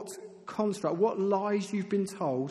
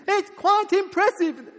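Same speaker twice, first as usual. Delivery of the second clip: very high, loud, stretched acted yell; shouted repeat over noise; 10 dB louder, slower than usual. Preacher repeating himself to a deafened friend, shouting slowly. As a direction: force each word over the roar.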